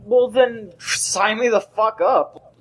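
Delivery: ordinary talk, nothing marked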